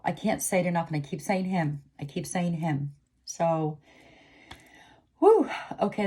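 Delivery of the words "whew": high, loud exhale of relief; intense whew. Real, not acted. Reader coming out of an intense reading, relieved whew. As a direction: let the tension out in a high whew. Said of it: "that was pretty intense".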